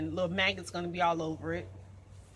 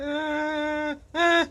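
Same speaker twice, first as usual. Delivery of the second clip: high, loud whimpers of dread; frightened whimper; 6 semitones higher, 7 dB louder than usual. Real, not acted. Woman recoiling from the bird, whimpering in fear. Scared to push the dead bird.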